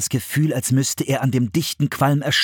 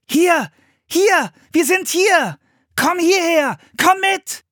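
loud, high-pitched repeated calls; calling out; 4 dB louder, slower than usual. A narrator's reading. Sister calling to her brother, shouting. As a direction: shout to be found through smoke. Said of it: "hörte Philipp seine Schwester rufen".

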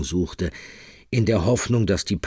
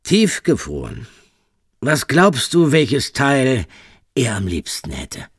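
higher, louder cruel threat; menacing taunt; 7 semitones higher, 6 dB louder, slower than usual. Acted. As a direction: taunt her with relish. Michele taunts Rosa with threat.

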